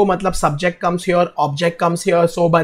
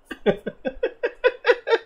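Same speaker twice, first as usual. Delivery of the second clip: real high-pitched chuckle; laughing; 5 dB quieter than usual. Real, not acted. Teacher laughs off students' accent requests, amused laughing.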